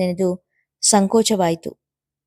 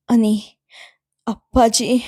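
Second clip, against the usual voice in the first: mild sob; 3 semitones higher, much slower than usual. Acted. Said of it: "ಎನ್ನುತ್ತಾ ಬಿಕ್ಕಿದಾಗ".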